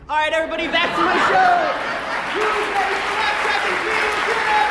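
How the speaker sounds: loud, high TV-host announcement; booming mock show-host sign-off; announcer shout. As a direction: belt it like a cartoon show host signing off.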